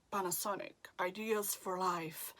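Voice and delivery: slogan voice, measured and understated; commercial voice-over. Shown as a demonstration. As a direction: read it like a smooth TV commercial tag. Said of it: "ou até mesmo comercial".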